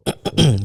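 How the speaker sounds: a quick, rough clearing of the throat. Real, not acted. Speaker on a vlog pauses to clear throat. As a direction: clear your throat before going on. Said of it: "sorry, clearing my throat".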